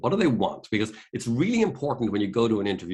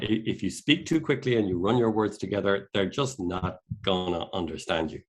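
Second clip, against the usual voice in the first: normal talking voice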